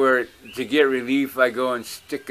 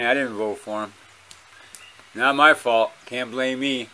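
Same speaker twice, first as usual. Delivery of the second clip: usual speaking voice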